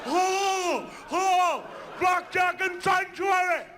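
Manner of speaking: high, loud, slow mocking whine; mocking gamblers. Mock whine of angry gamblers at the blackjack table. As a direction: shout it like scornful gamblers whining. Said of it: "They're like".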